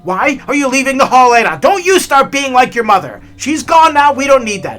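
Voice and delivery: loud, high angry yell; yelling at wife. Retold or imitated. Grandfather yelling at grandmother about her mother's habit, loud angry yell. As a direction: shout her down like a furious husband. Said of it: "So he yells at my grandmother again".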